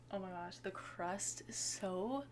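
soft, slow swoon. Real, not acted. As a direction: melt over the crust. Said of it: "I'm gonna start crying".

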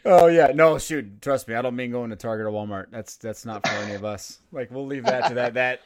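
ordinary talk

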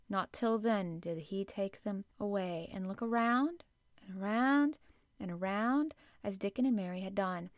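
ordinary delivery

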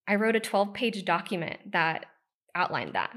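ordinary talk, nothing marked

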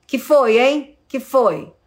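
irritated question picking a fight with a partner; harsh demanding question. Shown as a demonstration. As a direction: snap it at them like a challenge. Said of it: "tem gente que pergunta assim".

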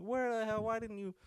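high-pitched retold exasperation; exasperated disbelief. Confronting the friend who left him waiting, retold high exasperation. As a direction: voice leaps up in mock disbelief. Acted scolding of missing friend.

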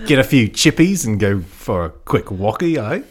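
mock Australian accent. Host playing up an Australian accent for his co-host. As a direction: lay the Australian on thick, slowly. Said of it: "I did go a little Australian".

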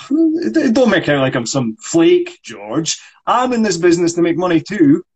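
a touch of an indignant actor; frustrated actor. Acted. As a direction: insist on being heard. S1 reads Michael.

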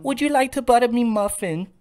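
flirty mock English falsetto; terrible English accent. Showing their bad English accent, hammed-up. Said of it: "our terrible English accents".

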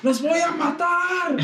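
high, slow mock threat; a bullied kid threatening everyone, hammed up high and slow; mock angry yell. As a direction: stretch out a hammed-up scream of rage. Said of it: "Gritaba así".

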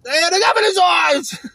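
fed-up hostess loudly griping; exasperated outburst. Retold or imitated. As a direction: blurt it out fed up, voice up high. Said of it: "they say".

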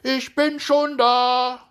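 high, loud, drawn-out call. A narrator's reading. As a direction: sing out in falsetto across a field. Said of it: "so rufst du ihm entgegen".

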